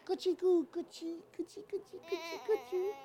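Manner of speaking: high, sing-song tickle coo; tickling baby talk. Acted. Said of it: "he tickled under the baby's nose".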